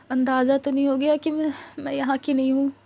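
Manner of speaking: ordinary delivery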